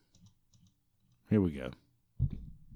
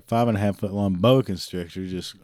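ordinary delivery

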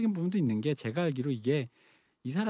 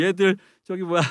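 mock Korean American accent; imitating a Korean American accent; 5 semitones higher, 5 dB louder than usual. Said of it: "와이지의 교포 발음".

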